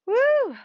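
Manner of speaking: high, loud whoop of relief; excited woo. Real, not acted. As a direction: cheer it out after the effort. Instructor cheers after hard set.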